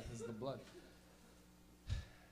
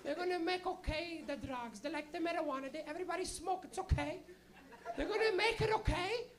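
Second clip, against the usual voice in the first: put-on high, slow Portuguese-accented mother; high Portuguese mom voice, drawn out; little Portuguese lady; 9 semitones higher than usual. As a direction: imitate a scandalized Portuguese mom, pitched way up. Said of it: "she's a little Portuguese lady".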